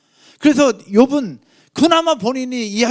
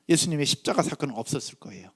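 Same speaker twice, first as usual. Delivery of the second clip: ordinary talk, nothing marked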